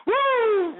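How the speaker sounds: loud, high celebratory whoop; high party whoop; gleeful whoop. Real, not acted. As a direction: whoop in triumph.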